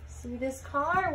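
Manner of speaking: ordinary delivery, nothing marked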